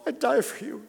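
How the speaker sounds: strained, emotional declaration of devotion; tearful vow. Retold or imitated. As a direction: vow it to your newborn through tears. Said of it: "tears flowing down my cheeks".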